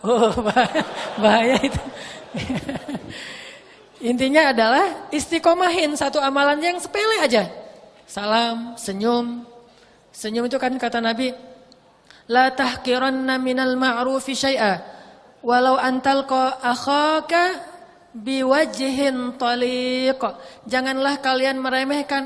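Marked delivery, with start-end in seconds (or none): 0.0-4.1 laughing through the words; laughing amusedly. Real, not acted. Laughing at own joke. 12.3-20.2 slow, measured Arabic recitation; slow, reverent recitation of the Arabic text; hadith recitation. Acted.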